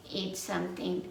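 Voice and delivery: usual speaking voice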